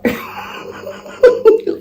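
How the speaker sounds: breathy high burst of laughter; cracking up, high and breathy; high real laugh. Real, not acted. Laughing at her own joke.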